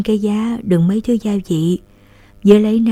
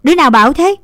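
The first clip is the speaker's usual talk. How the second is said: higher, louder stern question; sharp demand; 7 semitones higher, 6 dB louder, much faster than usual. A narrator's reading. Big sister demanding who said father is dead, stern. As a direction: cut in sharply. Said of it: "Linh chật giọng".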